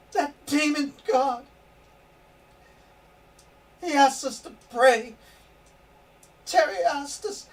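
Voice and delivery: high, loud desperate plea, near shouting; frantic pleading. Acted. Frantic wife begging husband to save their son. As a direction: beg him to pray, terrified for the son.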